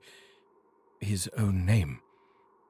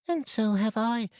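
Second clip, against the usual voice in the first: put-on high little child's voice exclaiming; small child exclaiming; 13 semitones higher than usual.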